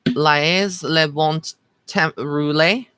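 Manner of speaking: slow, careful sounding-out of "Laez le bon temps roulé" in a hesitant Creole French attempt; tentative French pronunciation; unsure French reading. Shown as a demonstration. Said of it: "I don't know how you say that".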